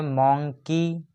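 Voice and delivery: Monkey slowly broken as मं - की; two separate beats on monkey; split into syllables. Shown as a demonstration. Teacher giving a two-syllable example, monkey split in two. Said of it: "ये सब टू syllable वाले".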